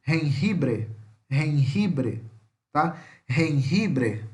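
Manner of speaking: "Jengibre" with throaty J's, repeated; teacher repeating "Jengibre" for the class; Spanish J sound. Shown as a demonstration. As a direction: drill "Jengibre", J like a rough R. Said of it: "que é som de R".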